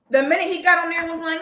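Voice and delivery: ordinary talk, nothing marked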